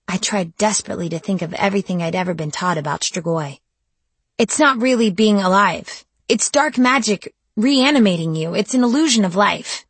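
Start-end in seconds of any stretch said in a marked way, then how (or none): 4.4-9.9 higher and louder, desperate argument; desperate insistence. Acted. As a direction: argue it desperately. Captive arguing desperately.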